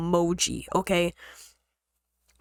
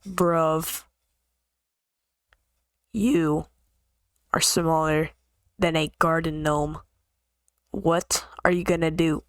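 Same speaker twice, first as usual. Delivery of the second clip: slow put-down; 4 semitones lower, slower than usual. Retold or imitated. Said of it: "I just say".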